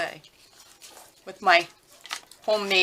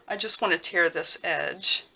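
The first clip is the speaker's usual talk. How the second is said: usual speaking voice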